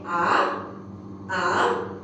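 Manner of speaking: ah sliding upward; rising second tone. Shown as a demonstration. Teacher models rising second tone for class. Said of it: "The second one is a rising tone".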